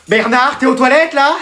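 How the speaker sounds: ordinary talk, nothing marked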